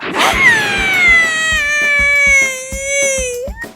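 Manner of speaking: long high cackling laugh; shrill hysterical laugh. Acted.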